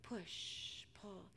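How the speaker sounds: hushed, rhythmic rowing chant; very soft storybook hush; quiet rowing. A narrator's reading. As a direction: barely voice each stroke, with pauses. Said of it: "Quietly, the disciples rowed".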